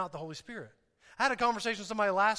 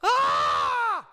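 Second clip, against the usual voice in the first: high, loud mock scream of panic; panicked shout; 9 dB louder than usual. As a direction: emotions yelling their heads off. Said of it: "my feelings and my emotions are going".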